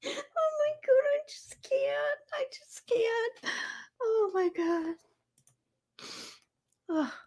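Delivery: high, squeaky, breathless laughing cries; crying with laughter. Real, not acted.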